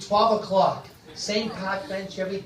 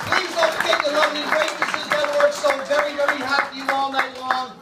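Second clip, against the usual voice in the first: loud, high crowd hype; rally the room to scream; host's closing call; 9 semitones higher, 6 dB louder than usual. Acted. Host thanking the crowd and staff, loud and high.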